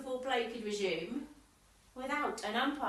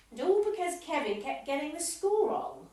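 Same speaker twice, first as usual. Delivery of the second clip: ordinary delivery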